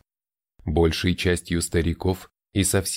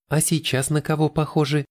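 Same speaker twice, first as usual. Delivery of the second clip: put-on higher curious girl's question; любопытная девочка; 7 semitones higher than usual. A narrator's reading. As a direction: ask with childlike curiosity. Рейна asks, curious child. (любопытный детский вопрос)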